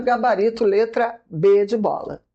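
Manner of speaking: ordinary delivery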